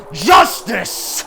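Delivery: one loud drawn-out yelled word; deep scream. A narrator's reading. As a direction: bellow it like a verdict. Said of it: "a deep voice screams".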